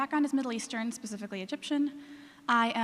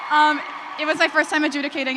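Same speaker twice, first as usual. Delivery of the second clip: raised voice; 4 semitones higher, 9 dB louder than usual. Real, not acted. Repeats the line over the cheering.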